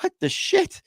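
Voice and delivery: squeaked way up high, startled; stunned disbelief. Retold or imitated. Young lifter reacting to the gym giant, high startled squeak. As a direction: blurt it in shocked confusion. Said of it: "And I'm just like".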